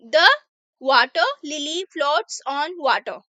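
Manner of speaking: slow, careful English read for learners; teacher reading an example sentence slowly; slow reading aloud. Shown as a demonstration. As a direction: read each word out slowly for the class.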